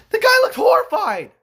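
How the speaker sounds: way up and loud, incredulous; high loud exclaim. Real, not acted. Telling viewers the villain's face, in a high loud exclaim. Describing Palpatine's reaction.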